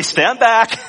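a hammed-up magician's order; mock showman commanding the crowd. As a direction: bark it like a showman clearing the stage. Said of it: "tell everybody".

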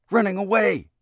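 high, loud gasped cry; boy gasping that the car runs away; gasped in terror. Acted. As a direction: gasp it out as if panicked. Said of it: "he gasped".

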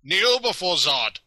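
high, loud commanding Zod voice; booming arrogant alien overlord. Retold or imitated. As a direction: order them to kneel. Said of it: "They gotta throw in the line".

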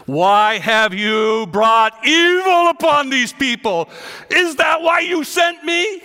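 loud, high, slow cry of protest at God; anguished cry. Acted. Moses protesting to God, loud high anguished cry.

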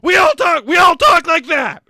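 loud high shouted impression; shouted mock German speech; mock German shouting. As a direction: yell it like a ranting Nazi. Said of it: "they're like".

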